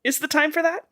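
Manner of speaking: high incredulous disbelief; really, you're doing this now; exasperated. Retold or imitated. Exasperated quote to character.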